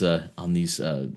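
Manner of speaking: ordinary talk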